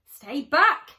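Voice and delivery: loud warning shout. Acted. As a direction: bark it to keep him away. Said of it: "shouted Bruce".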